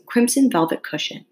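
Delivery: usual speaking voice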